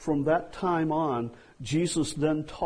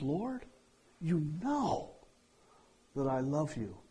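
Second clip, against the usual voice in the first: exasperated; 5 dB quieter than usual. Retold or imitated. Peter to Jesus, asked a third time, exasperated. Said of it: "Peter got exasperated at that".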